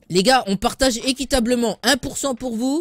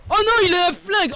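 higher pitch, sudden alarm; surprised alarm; 7 semitones higher than usual. Real, not acted. Streamer seeing an enemy draw a gun, alarmed. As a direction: react in shock.

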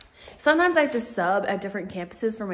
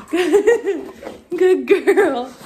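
giggling praise to the dog; laughing praise; 8 semitones higher, 9 dB louder than usual. Real, not acted.

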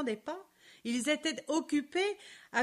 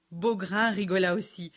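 ordinary delivery, nothing marked

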